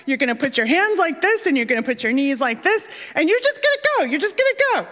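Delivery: imitating a keyed-up dad coaching, pitch climbing high by the end; hyped dad. Dad teaching his kid to dive on the platform, hyped. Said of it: "hyped and he's like".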